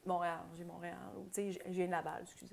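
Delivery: small, softened, almost sorry to say it; apologetic. Retold or imitated. Cohost mimics Quebecers admitting their hometown, meek and apologetic. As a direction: play it sheepish, dropping away quietly. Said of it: "on s'excuse un peu quasiment".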